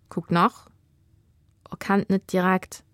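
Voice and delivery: ordinary delivery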